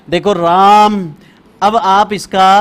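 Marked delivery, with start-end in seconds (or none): 0.1-1.1 राम said slowly and louder, long open आ; teacher loudly stretching राम so pupils hear the vowel; clear pronunciation demo. Shown as a demonstration. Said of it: "अब उच्चारण कर रहा हूं".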